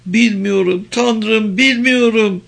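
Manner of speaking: high, anguished helplessness; high-pitched despair under questioning; desperate. A narrator's reading. Pressed captive despairs she doesn't know.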